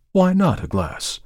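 high, slow, reedy shopkeeper; a prickly old shop dealer. Acted. The dealer's reply.

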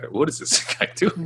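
high, laughing mock disbelief; mock baffled laugh. Professors puzzled by a keen student, laughing high. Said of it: "they were just like".

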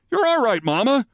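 high-pitched calling out; mock shout. A narrator's reading. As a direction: call out high to calm a panicking mother. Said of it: "he shouted".

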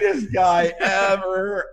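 high, drawn-out fan gush; hammed-up hero worship; starstruck. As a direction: stretch each word in rapturous praise. Retold starstruck reaction to meeting actor.

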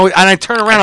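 ordinary talk, nothing marked

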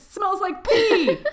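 high, loud laughing. Real, not acted. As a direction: laugh through the words.

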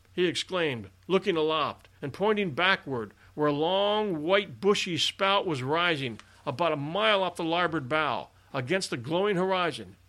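ordinary delivery, nothing marked

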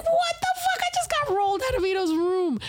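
high-pitched outraged surprise; stunned disbelief. Retold or imitated. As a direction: replay the moment you couldn't believe it. Shocked at being locked out.

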